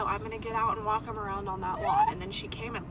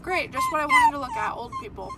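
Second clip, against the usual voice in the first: sarcastic. Real, not acted. Mocking the people outside.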